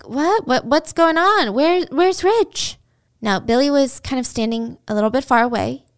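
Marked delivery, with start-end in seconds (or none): 0.0-2.8 confused surprise. Retold or imitated. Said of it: "neighbors are looking at her like".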